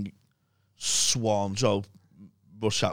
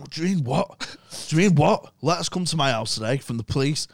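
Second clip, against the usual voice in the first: Steve-O threatening; 5 semitones higher, 4 dB louder than usual. Retold or imitated. Steve-O confronting Mike.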